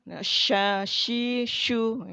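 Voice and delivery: sha, shi, shu with plenty of breath spread; airy shin. Shown as a demonstration. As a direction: blow air through each sh. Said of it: "Ada anginnya".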